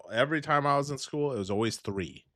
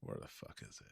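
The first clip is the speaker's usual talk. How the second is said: muttered under breath; 25 dB quieter, faster than usual. Real, not acted. Searching for the clip.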